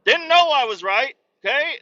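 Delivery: high, loud astonished outburst; shocked that Cletus was right; stunned listener. Pretend. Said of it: "and you're like".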